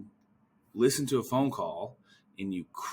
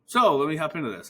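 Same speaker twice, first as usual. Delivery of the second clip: canned response; 9 semitones higher, 9 dB louder than usual. Shown as a demonstration. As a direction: rattle off a rehearsed answer. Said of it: "this canned response of".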